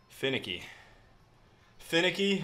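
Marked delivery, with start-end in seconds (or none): 1.8-2.4 incredulous echo. Real, not acted. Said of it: "Who says that, dude?".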